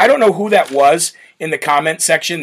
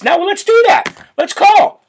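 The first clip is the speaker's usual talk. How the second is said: excited; 4 dB louder than usual. Real, not acted. Eager to phone the official.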